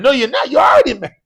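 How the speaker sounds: high, shouted mock outrage; hammed-up wife yelling her objection; mad wife. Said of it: "make your wife mad".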